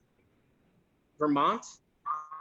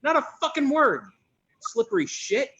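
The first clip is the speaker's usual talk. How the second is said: angry; 7 semitones higher than usual. Acted. Complaining about the absent boss.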